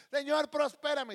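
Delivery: high, loud, slow plea; begging mock prayer. Believer begging God to prosper him, exaggerated loud plea. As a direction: implore heaven at full volume.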